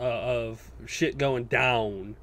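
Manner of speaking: ordinary talk, nothing marked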